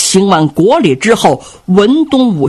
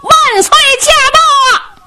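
loud herald's cry. A narrator's reading. Attendant announces the emperor's arrival, high ringing call. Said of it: "有人高声喊嚷".

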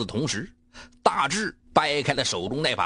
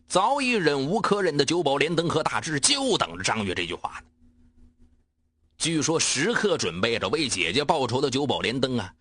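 usual speaking voice

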